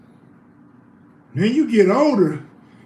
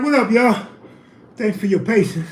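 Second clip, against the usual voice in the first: ordinary talk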